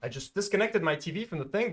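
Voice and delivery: usual speaking voice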